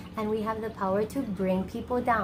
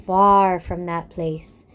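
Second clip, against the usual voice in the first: gentle, measured storyteller close; story narration; 6 dB louder than usual. A narrator's reading.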